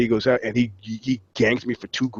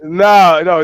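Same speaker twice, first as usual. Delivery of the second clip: firm refusal; 5 semitones higher, 8 dB louder than usual. Retold or imitated.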